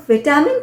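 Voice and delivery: विटामिन with a short "vit" first syllable; British pronunciation. Shown as a demonstration. Teacher showing learners the British variant, British pronunciation. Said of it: "British pronunciation".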